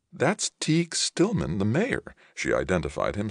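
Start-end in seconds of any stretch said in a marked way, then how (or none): 0.1-2.1 high-pitched, slow surprise; mother's voice pitched way up in surprise; surprised. A narrator's reading. Mother identifying an unexpected visitor at the door, surprised. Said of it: "sounding surprised".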